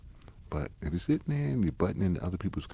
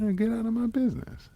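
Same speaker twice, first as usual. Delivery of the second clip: high mocking voice; 4 dB louder than usual. Friend voicing the retort.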